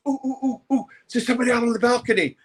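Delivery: high, fast, squealing alarm; giggly office girl. Retold or imitated. Office girl spotting the photographer, high and fast.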